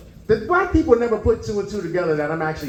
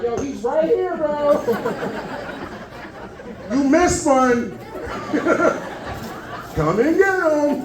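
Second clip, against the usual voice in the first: higher, slowed, teasing tip-off with laughs; mock snitching call; 8 semitones higher, much slower than usual. Comic grown man phoning in a tip, laughing, drawn out. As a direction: drawl it out like a gleeful snitch on the phone. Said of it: "on that phone like".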